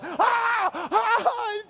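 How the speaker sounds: high mock scream of a terrified child; kid's scream. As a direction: squeal like a child fleeing a bug. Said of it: "he screams like it was on the hunt".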